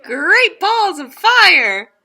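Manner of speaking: high, loud, slow cowboy exclamation; a goose hollering like a cowboy, drawn out; cowboy goose exclaiming. Acted. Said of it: "cried the goose".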